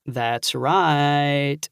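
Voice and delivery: hammed-up Southern drawl, teasing; exaggerated drawl. Nephew teasing his aunt on the phone, mock drawl. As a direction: stretch "right" out in a slow, mock country drawl. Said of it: "I said in an exaggerated drawl".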